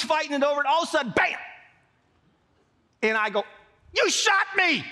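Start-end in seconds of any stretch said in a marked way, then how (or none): 3.9-4.8 high, indignant yelp; outraged cry. Retold or imitated. Boy just shot by sister's boyfriend, outraged cry. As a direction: cry out in shocked accusation. Acted outcry after being shot.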